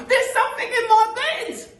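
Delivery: very high, loud falsetto scream; Missus Twit screaming. Acted. Missus Twit feeling something in bed, screaming. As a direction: shriek for help. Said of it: "screamed Missus Twit, bouncing about".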